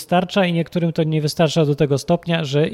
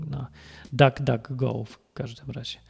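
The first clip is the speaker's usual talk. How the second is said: usual speaking voice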